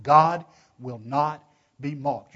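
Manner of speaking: "God will not be mocked" loud and very slow; emphatic. Shown as a demonstration. Preacher showing the voice behind scripture, "God will not be mocked" slow and emphatic. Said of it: "Did you hear that emphatic voice behind it?".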